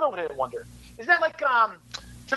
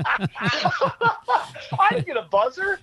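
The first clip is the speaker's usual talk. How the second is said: high, slow laughing protest; much slower than usual. Real, not acted. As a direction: laugh it out in mock outrage. Laughing complaint in quiz.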